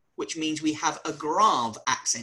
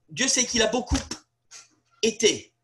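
long pause after beaucoup, then été; pause before été; 4 semitones higher than usual. Retold or imitated. Prime minister carefully avoiding saying pété. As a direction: hold the break so it is clearly été. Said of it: "what he said was".

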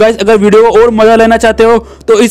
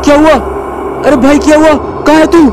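higher, urgent pleading to wake him; frantic, close to tears; panicked; 4 semitones higher than usual. Acted. Boy begging his collapsed brother to wake up, rising panic.

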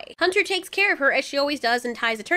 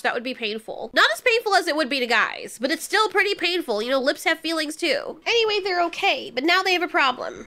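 ordinary talk, nothing marked

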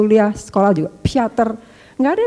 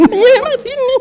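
high laughing reply. Real, not acted. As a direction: laugh as you answer. Tertawa menunjuk dadanya. (menjawab sambil tertawa)

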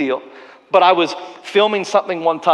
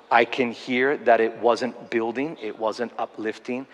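very soft, slow, lowered apology; humble and sincere, voice sunk low; sorry to the bone, softly and slowly; 5 semitones lower, 4 dB quieter than usual. Retold or imitated. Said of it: "moment of vulnerable humility".

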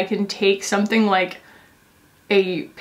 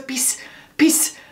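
put-on breathy, half-whispered little-kid "peace" for please; imitating her toddler; 5 semitones higher, much slower than usual. Mom imitating her son begging please as peace. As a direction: plead "peace, peace" like a tiny boy. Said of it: "And he goes".

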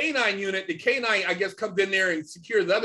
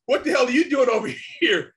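high, loud laughing cop bark; amused, loud imitation of a cop yelling; mock cop shout; 7 semitones higher, 6 dB louder than usual. Imitating cops spotting them.

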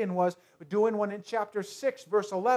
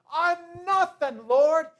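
pleading lament; 7 semitones higher, 6 dB louder, much slower than usual. Acted. Gideon voiced pleading to God.

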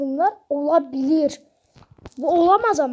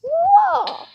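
high, loud drawn-out wow; şaşkınlık çığlığı; 6 dB louder than usual. Real, not acted. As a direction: let out a long, high wow. (şaşkınlıkla tiz, uzun bir çığlık)

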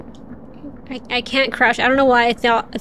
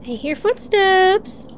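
high, slow eerie singsong; spooky singsong; 6 semitones higher, slower than usual. Pretend. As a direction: draw it out like a ghost story.

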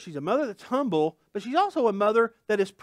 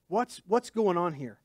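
normal talking voice

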